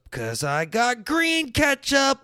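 slow, shouted ketchup news; excited mom reveal. Retold or imitated. Mom tells her kids about green ketchup, shouting happily. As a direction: announce green ketchup like a big prize. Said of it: "She goes".

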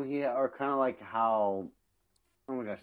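ordinary delivery

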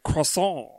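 "Croissant" said the French way, no final t; pretentious French "Croissant"; fake French pronunciation. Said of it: "that guy who's like".

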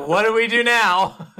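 high, loud mock-baffled voice; laughing imitation of nonplussed classmates; laughing bewilderment. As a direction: act lost for a response while cracking up.

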